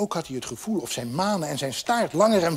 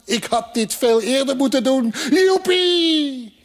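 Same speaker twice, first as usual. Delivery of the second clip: high, elated build to a whoop; rising, overjoyed cheer; exultant; 9 semitones higher, 4 dB louder than usual. Acted. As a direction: burst out joyfully, soaring at the end. Horse rejoices in flight.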